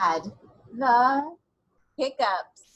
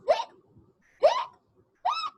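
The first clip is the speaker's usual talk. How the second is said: hiccups; 5 dB louder than usual. Pretend. Imitating skeleton's hiccups.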